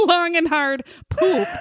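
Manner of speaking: laughing through the words. Real, not acted. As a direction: crack up while saying it. Cracking up at her own joke.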